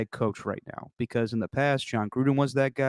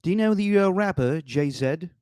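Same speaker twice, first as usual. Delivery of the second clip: imitating British friend; 6 semitones higher than usual. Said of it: "my favorite".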